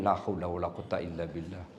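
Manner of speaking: weary low sigh-prayer. Acted. Exasperated aside about nagging.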